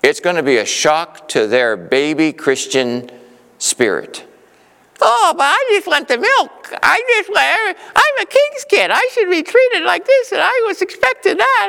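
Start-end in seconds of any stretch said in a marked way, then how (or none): 5.0-11.7 high, whiny mock voice of a spoiled believer. Preacher mocking immature believers in a whiny high voice. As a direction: mimic a spoiled child who wants only milk.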